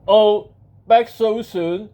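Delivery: slow, loud genie voice. Acted. Genie greeting a returning wisher, slow and loud. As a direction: draw it out loudly as an unhurried genie.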